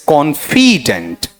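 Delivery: Confident slow, with a heavy FEE in the middle; confident as "kon-FEE-dent", drawn out; stress on middle syllable. Shown as a demonstration. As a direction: lean hard on "fi" in confident. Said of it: "खाली stress".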